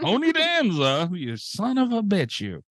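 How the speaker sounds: high, slow drawn-out mock cursing; mock dramatic curse. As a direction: drag the name out like a hammy villain curse. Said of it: "Every time people just say".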